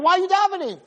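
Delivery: high-pitched scolding demand; mock yelling. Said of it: "Hashem's screaming at him".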